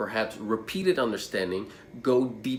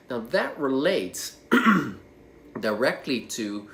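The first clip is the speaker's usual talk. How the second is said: ordinary talk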